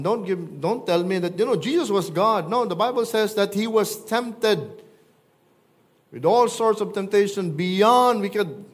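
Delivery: preaching force. Acted. Preaching builds up.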